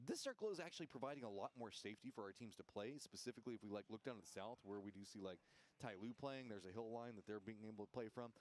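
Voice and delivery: lively play-by-play commentary; esports caster. Acted. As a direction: call the match like a caster.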